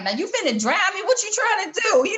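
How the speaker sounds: high-pitched comic disbelief, climbing as it goes; mock-panicked complaint, voice shooting up; alarmed protest. Retold alarmed protest in pool.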